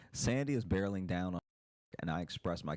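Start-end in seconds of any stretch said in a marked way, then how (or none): none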